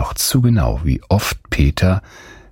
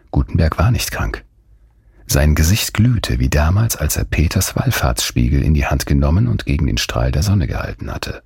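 usual speaking voice

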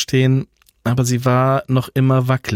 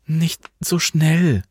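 slow, much higher, winded plea; out of breath; 8 semitones higher, much slower than usual. Acted. Alva, winded from hurrying, begging Franz to slow down. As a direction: gasp it out after running. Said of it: "begann Alva zu schnaufen".

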